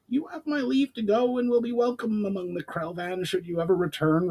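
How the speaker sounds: high goblin voice; a goblin chief granting leave; Brawl, formal goblin. A narrator's reading. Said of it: "replied Brawl".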